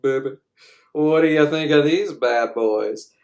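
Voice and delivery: sultry come-on voice; hammed-up seductress flirting; seductive Gorgeous. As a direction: purr it like a temptress. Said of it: "she's like".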